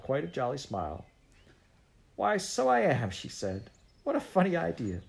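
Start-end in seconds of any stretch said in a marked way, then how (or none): 2.2-3.1 high, lilting cheerful surprise; jolly. A narrator's reading. Missus Biddle suddenly turns sweet-tempered, jolly. 4.0-5.0 high, amused lilt; jolly. A narrator's reading. Missus Biddle, magically good-tempered, answers the children, jolly.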